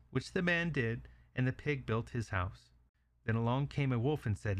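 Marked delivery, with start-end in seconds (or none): none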